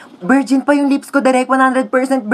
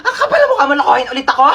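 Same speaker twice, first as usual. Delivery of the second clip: angry rant; 2 semitones higher, faster than usual. Acted. Angry rant at cheating partner.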